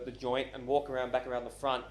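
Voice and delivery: plain reading voice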